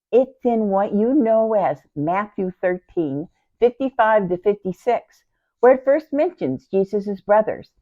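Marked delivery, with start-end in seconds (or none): none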